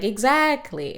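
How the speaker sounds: mock triumphant Buster. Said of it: "And Buster's like".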